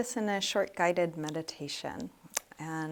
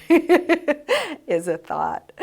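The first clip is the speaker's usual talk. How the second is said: laughing through the words; high, loud burst of amused laughter; 11 semitones higher, 11 dB louder, much slower than usual. Real, not acted. Meditation teacher laughing at her own example, amused.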